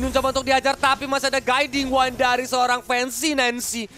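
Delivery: hyped play-by-play, loud and high; esports caster. Real, not acted. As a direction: call the teamfight like a caster. Live play-by-play at teamfight. (komentator esports yang bersemangat)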